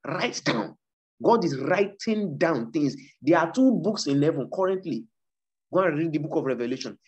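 ordinary talk

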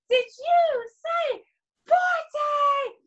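high, slow, loud shout; loud call. Shown as a demonstration. Teacher urging kids to shout forte. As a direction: call it out big for the class. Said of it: "Can you say forte?".

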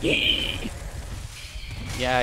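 long, voiceless screechy "yeah"; mock the enemy with a drawn-out screech; imitated screech. Player mocks an enemy's screeches, imitated screech. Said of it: "You and your screeches".

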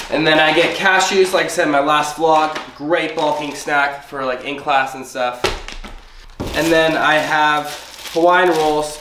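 usual speaking voice

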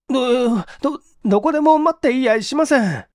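put-on scared young man stalling; high stuttered denial; frightened stammer. Okamoto stammers.

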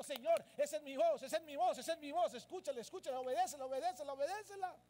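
loud, insistent repeated urging; urgent preaching cry. Acted. Preacher urging the church to heed God's voice, insistent. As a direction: drive each repeat harder, building in urgency.

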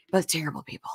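hushed, conspiratorial repeat; whispered aside. Retold or imitated. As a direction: whisper it under your breath.

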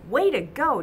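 loud, high cheer. Acted. Pete cheering his friend Ben's home run, loud cheer. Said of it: "cheers Pete".

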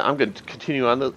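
usual speaking voice

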